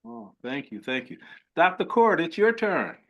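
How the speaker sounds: ordinary talk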